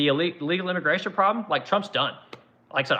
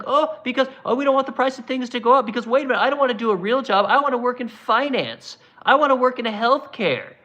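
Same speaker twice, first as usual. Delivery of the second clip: high-pitched parody of entitled complainers; derisive, whiny mock protest; mocking whine; 7 semitones higher than usual. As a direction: imitate a spoiled whiner, voice pushed up. Mocks educated people's excuses.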